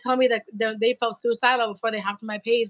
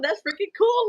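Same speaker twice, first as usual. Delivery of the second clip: high, thrilled exclamation; excited; 9 semitones higher than usual. Retold or imitated. Streamer retelling her thrilled reply to a fan, excited. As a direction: squeal it out with delight. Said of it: "I was really excited about it".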